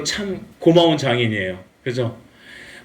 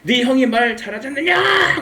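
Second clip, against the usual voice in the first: high, exasperated scolding as God; hammed-up angry retort; acted divine anger; 12 semitones higher than usual. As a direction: play God losing patience, pitch soaring. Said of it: "하나님 엄청 열받으셨어요".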